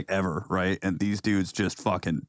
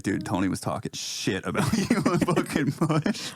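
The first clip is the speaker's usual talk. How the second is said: mock tattletale; 6 semitones higher than usual. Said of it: "message Jess and be like".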